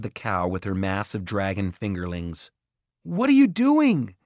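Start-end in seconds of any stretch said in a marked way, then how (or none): 3.0-4.2 loud, high alarmed call; calling after. A narrator's reading. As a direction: shout after him in alarm. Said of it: "Jared called after him".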